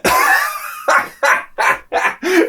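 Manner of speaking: shrill burst of real laughter; high laugh. Real, not acted. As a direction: crack up high. Laughs at the movie line.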